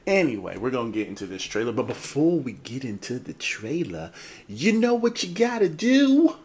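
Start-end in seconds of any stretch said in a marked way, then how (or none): 4.5-6.3 high, loud, rising hype question; hyped-up call-out. Pretend. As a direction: pump up the crowd with a stretched question. Hyped lead-in to subscribe plea.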